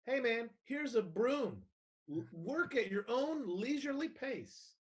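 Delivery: exaggeratedly easygoing, sing-song sarcasm; mock-helpful suggestion. Acting out handing over a broom.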